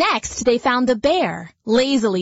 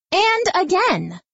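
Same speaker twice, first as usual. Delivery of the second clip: "again" lifted higher than the last; pitched up; 9 semitones higher than usual. A narrator's reading. Narrator building the refrain, pitched higher. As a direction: build the repeat upward.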